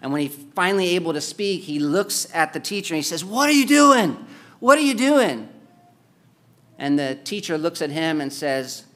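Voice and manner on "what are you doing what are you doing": shocked outburst. Retold or imitated. Gasping student shouts at teacher.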